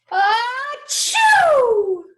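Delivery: loud, high, exaggerated achoo; big sneeze. Bear sneezes in story.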